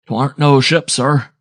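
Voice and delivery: plain reading voice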